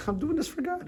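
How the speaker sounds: very high, innocent little voice; mock pious excuse. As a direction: play the innocent with a sanctimonious squeak. Cake eater's pious excuse.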